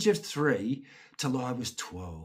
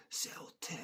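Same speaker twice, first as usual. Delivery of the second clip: whispered; 14 dB quieter than usual. Real, not acted. Fond aside about club.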